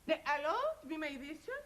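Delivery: usual speaking voice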